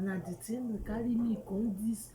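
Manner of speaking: ordinary talk, nothing marked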